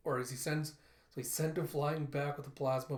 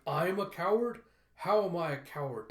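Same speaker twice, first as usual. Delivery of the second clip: raised, loud incredulous retort; indignant father; 4 semitones higher, 5 dB louder than usual. Retold or imitated. Father answers his grown daughter's insult, indignant. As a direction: throw the accusation back, offended.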